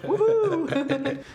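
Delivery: high, drawn-out "woohoo"; playful whoop. Pretend. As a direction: let out a long mock-celebratory whoop. Playful cheer about future children.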